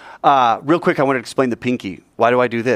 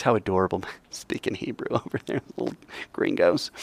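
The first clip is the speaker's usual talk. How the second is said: soft, chuckling aside; amused, doting God; 6 semitones lower, 9 dB quieter than usual. Acted. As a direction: coo quietly over them, half laughing. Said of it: "I think he thinks".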